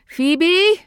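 loud high cry into the dark. Acted. Mother calls into the drain pipe, loud high cry. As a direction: cry her name into the pipe. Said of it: "she cried".

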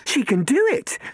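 high, loud amazement; astonished. Acted. Catherine astonished as Robin lifts off. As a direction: gasp it out, amazed. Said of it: "Catherine said in astonishment".

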